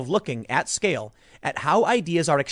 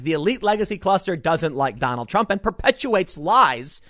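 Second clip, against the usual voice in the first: ordinary talk, nothing marked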